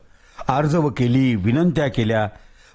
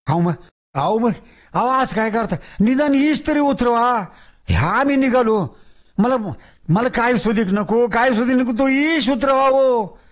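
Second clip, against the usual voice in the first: higher, bargaining whine; wheedling ghost; 9 semitones higher than usual. Acted. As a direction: beg and bargain. Ghost pleading.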